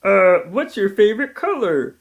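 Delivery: high put-on caricature voice; Coco impression. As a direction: pitch way up to play a friend. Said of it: "I'm gonna pretend to be Coco".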